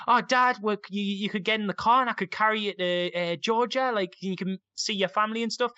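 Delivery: put-on high, eager little-boy chatter; excited kid. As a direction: bubble over with a child's excitement. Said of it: "so excited, going".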